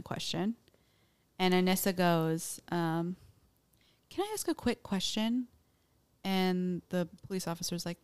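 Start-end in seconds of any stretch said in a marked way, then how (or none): 4.1-5.5 lightly voiced girl, pitched higher; a hint of a little girl asking; mock young girl. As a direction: ask timidly in a higher put-on voice. Quoting the girl in police interview.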